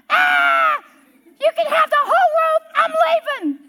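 loud, very high scream, acted out; hammed-up hollering panic at full pitch; panicked shriek. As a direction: shriek and bail out in terror. Lady shrieks and flees the tarantula.

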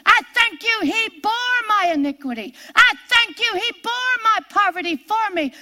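high, emphatic thanksgiving prayer; preaching to herself. Shown as a demonstration. Speaker preaching the gospel to herself, emphatic prayer of thanks. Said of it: "I preach the gospel about me".

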